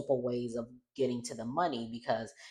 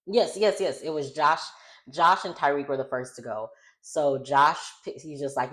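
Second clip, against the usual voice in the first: ordinary delivery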